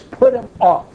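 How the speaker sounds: "Put" hit high and loud as a firm order; emphatic command. Acted. Preacher quoting God's command with firm emphasis. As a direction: quote scripture as a stern command. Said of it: "It says".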